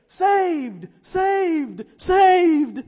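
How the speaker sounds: high, loud shout, building to the last; exultant shouting. Acted. Rescued dog celebrating as if saying it, shouting. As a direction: shout each one out in wild relief. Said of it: "screeching and screaming and shouting as if saying".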